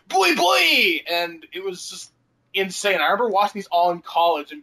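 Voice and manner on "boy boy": high, loud mock yell of Arnold; mock Schwarzenegger shout. Retelling a gum ad, hammed-up loud Arnold yell. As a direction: bark it like a hammed-up action star. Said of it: "and he's like".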